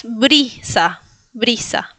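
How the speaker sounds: "brisa" with a smiling Latin I; Spanish I. Shown as a demonstration. Teacher shows how Spanish speakers say it, smiling I. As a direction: spread the lips for a clear ee in "brisa".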